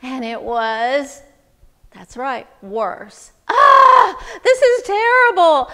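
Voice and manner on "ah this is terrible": high, loud wail of dismay; anguished outcry. Acted. Man complaining in his crowded hut, wailing loudly. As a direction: cry out in exasperation.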